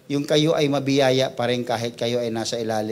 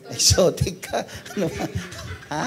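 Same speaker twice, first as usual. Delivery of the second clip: laughing; 5 semitones higher than usual. Acted. Joking about calling someone ugly.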